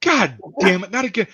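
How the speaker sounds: high-pitched, whining imitation of a ranting man; exasperated rant. Janitor cursing the filthy stall, high exasperated rant. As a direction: rant in mock despair, voice pushed way up. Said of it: "he's just going on a rant".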